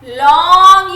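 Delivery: Long drawn out long and loud; long held to show length; stretched emphasis. Shown as a demonstration. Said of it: "कैसे बोले हैं".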